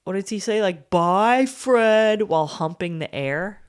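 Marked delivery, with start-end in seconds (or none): 0.9-2.2 loud, higher goodbye jeer; mock taunting farewell. Boyfriend taunts the departing ex-husband, mock jeering. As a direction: call it out like a cocky boyfriend, pitched up.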